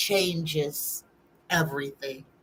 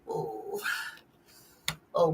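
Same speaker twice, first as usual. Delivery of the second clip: usual speaking voice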